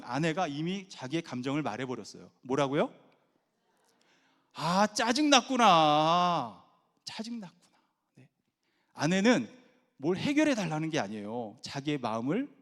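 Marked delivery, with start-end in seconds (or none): 4.5-6.6 loud, long drawn-out acknowledgement; empathetic echo. Shown as a demonstration. Husband echoing wife's feeling, loud drawn-out empathy. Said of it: "감정을 얘기해주면 돼요". 7.0-7.8 high, soft acknowledgement; empathetic echo. Shown as a demonstration. Husband echoing wife's feeling, soft high empathy. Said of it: "감정을 얘기해주면 돼요".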